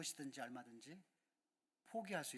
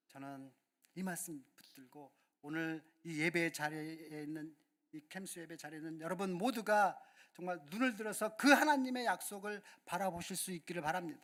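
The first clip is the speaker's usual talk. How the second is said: normal talking voice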